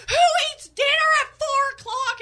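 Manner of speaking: very high, loud squawk; incredulous mockery. Friend mocks an early dinner, incredulous squawk.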